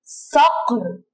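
صقر stretched very slowly; drawn-out word. Shown as a demonstration. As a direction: hold صقر long for the class. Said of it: "مثل كلمة". (مد كلمة صقر ببطء شديد)

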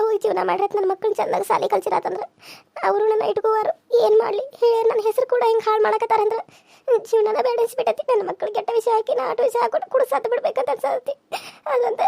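crying. Acted. Young wife telling her aunt her despair, sobbing. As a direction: weep as you speak of wanting to die. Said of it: "Kamalavva, ಅಳಬ್ಯಾಡ ಬಿಡು".

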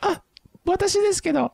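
very high, loud falsetto apology; squeaky falsetto. Pretend. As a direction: squeal it like a cartoon. Mock phone call, high squeak.

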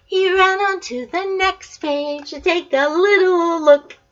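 lively picture-book read-aloud; reading to children. A narrator's reading. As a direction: read it like a bedtime book for a toddler.

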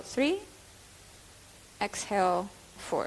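slow counted breathing guidance; guided meditation. Acted. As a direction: calmly count the breaths aloud. Leads a meditation.

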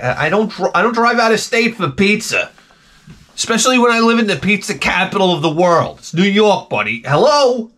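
telling the pizza guy off, exasperated; indignant retort. Retold or imitated. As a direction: scoff at him.

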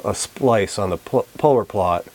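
ordinary delivery

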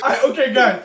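normal talking voice

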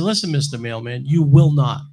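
builds to a loud flat no on will not; stern retold warning. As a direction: lay down the law to the mailman. Father forbids the mailman.

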